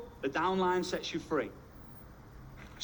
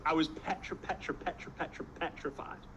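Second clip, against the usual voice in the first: hushed, stuttering show of fright; trembly repeated false starts, played for effect; mock petrified stutter; 6 dB quieter than usual. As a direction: stammer the word, dropping almost to a whisper. Acts out being petrified, stuttering.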